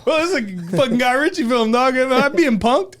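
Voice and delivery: high, loud baffled protest; retold incredulity. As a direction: act out thinking it's a prank.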